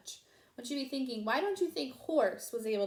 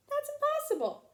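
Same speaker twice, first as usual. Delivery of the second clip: put-on high old lady. A narrator's reading. Grandma's disbelief.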